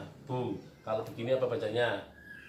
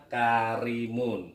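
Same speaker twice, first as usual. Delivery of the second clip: KARIMUN read slowly, syllable by syllable; drawn-out syllables; 3 dB louder, much slower than usual. Shown as a demonstration. Teacher shows how to read karimun, drawn out slowly. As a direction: stretch out each syllable of karimun. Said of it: "caranya satu persatu".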